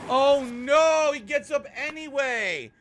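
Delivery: high loud panicked yell. Real, not acted. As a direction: shout in sudden dread. Zombie gets up again.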